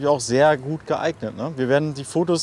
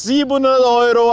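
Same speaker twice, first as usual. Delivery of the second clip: loud, high market barker shouting the price; market crier; 9 semitones higher, 8 dB louder than usual. Acted. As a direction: hawk the price like a fish-market seller. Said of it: "so als Marktschreier".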